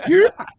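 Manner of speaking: sudden high, loud "Doop" with a rise; imitating a friend's last noise before crashing; Max's doop. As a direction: yelp it out like a startled squeak. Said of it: "Max goes".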